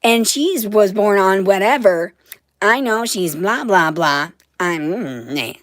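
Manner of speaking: loud, high mock of mom gushing; mock her gushing about star signs. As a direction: daughter mocks mom over astrology, loud and high. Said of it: "And she was like".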